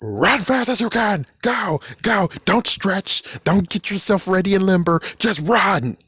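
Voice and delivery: hammed-up high yelling coach; mock pushy coach. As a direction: bark orders like an impatient trainer. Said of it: "You can't just be like".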